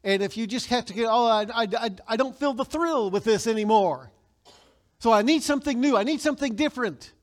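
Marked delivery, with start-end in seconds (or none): 1.1-7.1 mock whine, higher and louder, of someone bored with their stuff; whiny wanting voice. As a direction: play the spoiled consumer craving something new. Mocking a discontented buyer.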